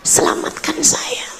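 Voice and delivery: a soft, breathless whispered "Selamatkan saya"; whispered plea. Retold or imitated. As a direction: whisper the plea, barely voiced. Prayer repeated in a whisper.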